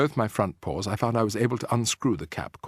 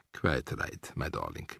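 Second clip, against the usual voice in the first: tender; 10 semitones lower, 9 dB quieter, slower than usual. A narrator's reading. Grandmother tender to grandson.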